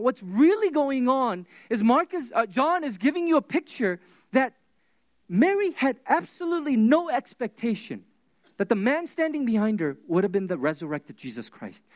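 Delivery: ordinary delivery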